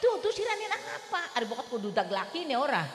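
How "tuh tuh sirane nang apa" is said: shrill high wailing question; crying neighbour. Retold or imitated. Preacher voicing a neighbour crying as she asks, high wail. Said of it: "Bari nangisku".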